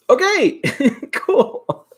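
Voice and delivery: high-pitched, laughing reaction; amused disbelief. Retold or imitated. Streamer recalling a viewer's backhanded compliment, amused and high-pitched. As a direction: squeak it out, breaking into a laugh.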